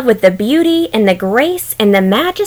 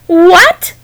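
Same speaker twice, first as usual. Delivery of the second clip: sharp, higher startled question; surprised; 8 semitones higher, 4 dB louder than usual. Acted. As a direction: blurt it out in shock. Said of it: "She was so surprised".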